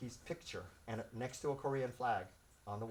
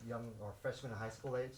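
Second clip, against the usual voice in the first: usual speaking voice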